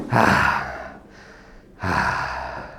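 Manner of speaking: low breathy exhales; fading, exhausted sighs; spent sighs. Acted. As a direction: breathe out as if drained by rapture. Winding down after acted ecstasy.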